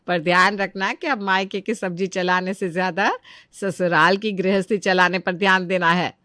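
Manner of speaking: ordinary talk, nothing marked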